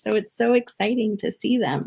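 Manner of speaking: ordinary talk, nothing marked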